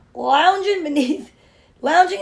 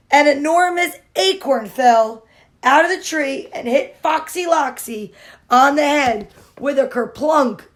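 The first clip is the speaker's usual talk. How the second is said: usual speaking voice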